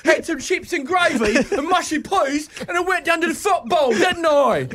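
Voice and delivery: high, put-on English accent; hammed-up English lad, pitched well up. Teasing the departing co-host about his future accent, mock English accent. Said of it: "you'll change your accent real quick".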